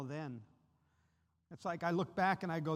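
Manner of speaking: usual speaking voice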